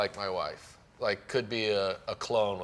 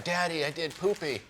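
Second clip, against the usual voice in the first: lightly voiced small child, higher pitch; little daughter; 6 semitones higher than usual. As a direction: proudly announce it like a toddler. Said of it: "hear her talking. She goes".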